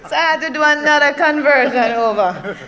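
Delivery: laughing. Real, not acted. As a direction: laugh at yourself as you tell it. Laughing at own accent story.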